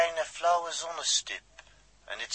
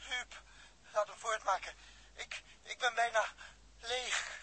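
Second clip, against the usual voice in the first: short of air; 9 semitones higher, 4 dB quieter than usual. Acted. Nearly out of air, urging.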